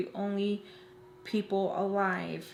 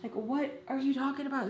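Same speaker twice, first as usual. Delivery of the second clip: high-pitched, distressed protest without real volume; lightly hysterical retold outburst, pitched up; mock upset screaming; 7 semitones higher than usual. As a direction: re-enact a frantic, pleading defence. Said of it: "screaming and crying".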